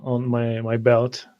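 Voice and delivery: normal talking voice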